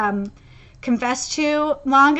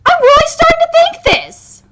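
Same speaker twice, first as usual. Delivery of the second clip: high, loud real outburst; agitated; 8 semitones higher, 5 dB louder than usual. Real, not acted. Fan realizing who Kaneki might face, agitated. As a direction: squeal the theory out, worked up. Agitated theory about the manga's ending.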